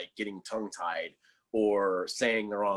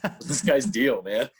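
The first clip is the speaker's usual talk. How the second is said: amused retelling of a baffled bystander, louder; puzzled onlooker, laughing; 2 semitones higher, 5 dB louder than usual. Retold or imitated. Visitor reacting to a man in buckskins, laughing mock bafflement. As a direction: quote it through a laugh, raised slightly. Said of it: "and people".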